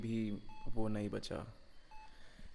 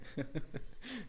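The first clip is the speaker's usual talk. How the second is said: sadistic giggle; 6 semitones higher, 5 dB quieter than usual. Acted. Torturer laughs over victim.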